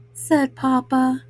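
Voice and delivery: ordinary delivery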